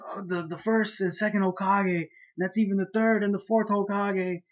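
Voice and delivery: high, stammering mock amazement; astonished onlooker. Voices clan member's astonishment.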